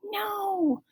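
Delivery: small quiet no; hush, barely voiced; quiet girlish no; soft whispered no. A narrator's reading. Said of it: "Colleen whispered".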